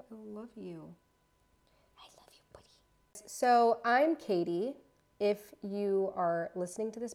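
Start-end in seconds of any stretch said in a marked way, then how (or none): 0.1-2.7 hushed, fast whisper to the dog. Retold or imitated. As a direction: whisper your love like a secret to the dog. Whispered love to her dog.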